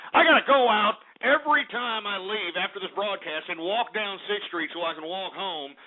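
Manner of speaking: ordinary talk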